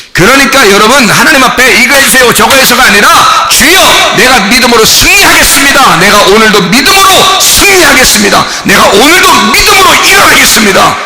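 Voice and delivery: loud, high shouted declaration; full-force preaching. Acted. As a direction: cry it out as a vow to the Lord.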